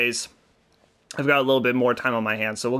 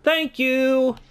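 high-pitched, drawn-out thank you; pleased, high singsong; delighted thanks; 12 semitones higher, 3 dB louder than usual. Real, not acted. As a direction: sing out your thanks, high and gleeful. Delighted at being given the dagger.

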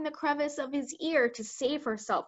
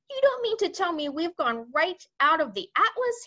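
high-pitched, alarmed disbelief; terrified cry; 7 semitones higher, 4 dB louder than usual. A narrator's reading. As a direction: read it as a panicking army chief. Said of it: "he cried".

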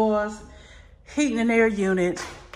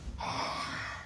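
a long, soft, breathy sigh of relief; contented sigh; 15 dB quieter than usual. Real, not acted. Sinking into the tub, a contented sigh. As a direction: let it all out as you sink in.

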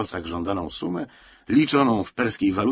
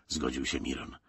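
ordinary delivery, nothing marked

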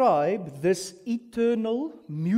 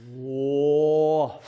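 drawn-out awed whoa, louder; amazed whoa; 3 semitones lower, 5 dB louder than usual. Shown as a demonstration. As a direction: let the wonder spill out. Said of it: "make you go".

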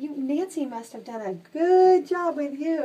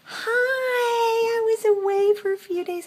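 pet talk; 12 semitones higher, 5 dB louder, slower than usual. Real, not acted. Greeting the cat in pet voice.

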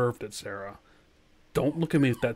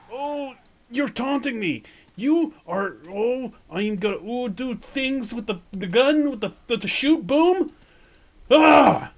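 high, stumbling threats building to a yell; flustered exasperation; 8 semitones higher, 7 dB louder than usual. Real, not acted. Gamer threatening a taunting dinosaur, flustered exasperation. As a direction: sputter in frustration, end in a shout.

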